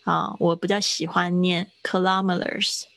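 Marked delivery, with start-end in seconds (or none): 1.8-2.8 kilometers as kuh-LAH-muh-ters, second syllable stressed. Shown as a demonstration. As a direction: hit "LOM" in kilometers. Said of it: "那个重音跑到拉上面". (kilometers重音落在“拉”上)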